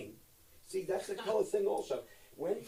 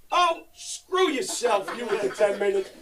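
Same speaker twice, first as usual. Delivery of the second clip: higher, louder and slow mock snap; mock outrage; 6 semitones higher, 11 dB louder than usual. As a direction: stretch it out in hammed-up indignation. Mock outrage at agent commission.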